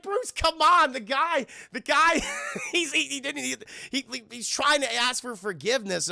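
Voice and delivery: high, stammering acted protest; imitated young hero's high-pitched exasperation; exasperated pleading. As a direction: plead in mock disbelief, half laughing. Terry pleads with stubborn Bruce.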